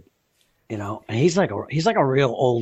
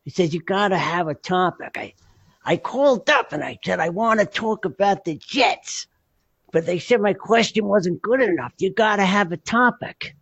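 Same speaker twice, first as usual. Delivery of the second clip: put-on high voice, stretching the key words; Freddie; 7 semitones higher than usual. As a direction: imitate an old-school pal griping about his calls. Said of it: "I'm gonna do Freddie voice".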